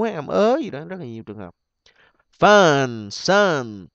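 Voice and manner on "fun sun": "Fun, sun" as separate drawn-out words with an open uh; mũ vowel. Shown as a demonstration. Teacher reading the options for students, drawn-out mũ vowel. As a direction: model "Fun, sun" loudly, stretching the short u. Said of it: "đều là âm mũ".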